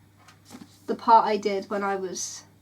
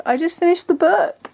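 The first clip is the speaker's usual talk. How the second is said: higher and louder, bursting with excitement; excited; 7 semitones higher, 8 dB louder than usual. Real, not acted. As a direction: announce it thrilled, just having finished. Excited announcement after finishing book.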